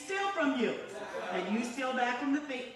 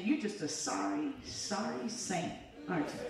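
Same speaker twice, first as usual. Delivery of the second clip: Jesus' gentle rebuke; 4 semitones lower, 4 dB quieter than usual. Retold or imitated. Jesus chides the apologizing believer, low imitated reproach. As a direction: imitate a knowing, understated scolding. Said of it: "I remember Jesus saying".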